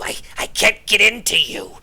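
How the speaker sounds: the little gnome baffled; puzzled gnome. Acted.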